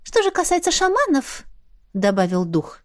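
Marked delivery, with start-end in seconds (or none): 0.0-1.4 high, squeaky little spirit, businesslike. Acted. Spirit talking business with the heroine, high squeaky voice. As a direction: pipe it up like a small sprite.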